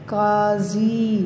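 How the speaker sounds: काजी stretched slowly, ending in a long ee; drawn-out long ee. Shown as a demonstration. Teacher modelling the first word for children, काजी drawn out to a long ee. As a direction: say काजी slowly, holding both vowels. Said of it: "उसमें छोटी यही आ रही है".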